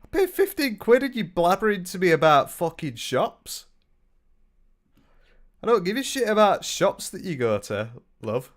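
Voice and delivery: northern English indignation, pitched high; really northern English accent. Real, not acted. Buyer griping about shop chat, really northern English accent. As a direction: go really northern as you complain. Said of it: "I don't know why I went really northern then".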